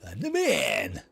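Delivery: loud victorious yell; triumphant shout. Real, not acted. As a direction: crow about your win. Gloating after a lucky catch.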